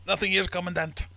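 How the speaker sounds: high, loud military report; soldier reporting. Acted. Soldier answering his commandant, high called-out report. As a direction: call it out to a superior officer.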